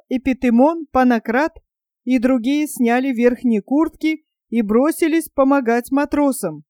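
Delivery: plain reading voice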